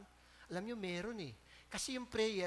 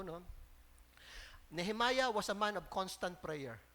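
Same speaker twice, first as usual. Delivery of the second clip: ordinary talk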